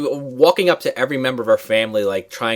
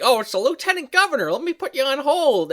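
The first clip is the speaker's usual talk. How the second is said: put-on much higher old-lady chirp; sweetly oblivious, high; ditzy grandma voice; 14 semitones higher than usual. Friend imitates grandma on the phone, high and sweetly oblivious. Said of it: "She's like".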